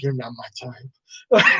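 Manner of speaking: low, slow deadpan; dry movie put-down; deadpan rejection. Retold or imitated. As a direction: drop it flat and slow. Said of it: "He's like".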